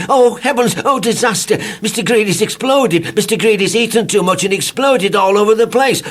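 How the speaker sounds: panicked wail. A narrator's reading. Panicked narrator after loud bang.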